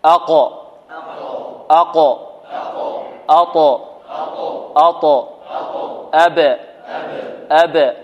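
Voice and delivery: each syllable short and firm, the final consonant of أق، أط، أب، أج، أد bouncing; qalqalah drill. Shown as a demonstration. Teacher reads for students to repeat, qalqalah drill. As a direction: bounce each stopped final letter, pausing for repetition. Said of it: "أقرأها وتردون خلفي هكذا".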